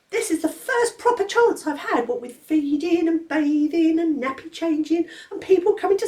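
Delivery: put-on a clever baby grumbling about grown-ups; indignant baby George.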